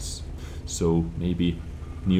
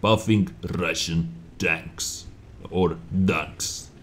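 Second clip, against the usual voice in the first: slow, heavy put-on Russian accent; slower than usual. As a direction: drag it out in a thick Russian accent. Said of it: "rate my Russian accent".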